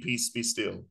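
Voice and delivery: very low, soft and hushed command; calm the sea with quiet authority; deep, quiet rebuke to the storm; commanding Jesus. Retold or imitated.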